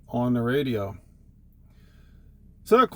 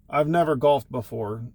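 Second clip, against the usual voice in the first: ordinary talk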